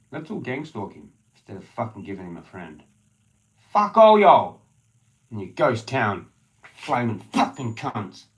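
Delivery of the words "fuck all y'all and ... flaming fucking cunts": screaming curses at the whole town, much higher and louder; furious yell. Real, not acted. Ranting alone at the town, furious shout. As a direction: yell it with real rage.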